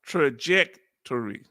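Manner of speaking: trajectory slowly as "truh-JEK ... tor-ee"; slow trajectory, pausing before the ending; careful pronunciation. Shown as a demonstration. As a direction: announce trajectory syllable by syllable. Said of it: "let me say trajectory".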